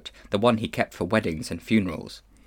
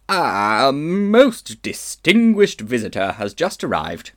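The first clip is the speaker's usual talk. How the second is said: put-on pompous old gentleman; 4 dB louder than usual. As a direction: puff himself up grandly. Uncle Andrew boasting of visitor.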